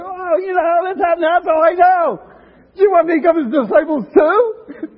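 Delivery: beaming, carefree voice shouting with a laugh; dumb and happy. Acted. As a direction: blurt it out like a giddy, clueless man. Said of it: "He was dumb and happy. He said".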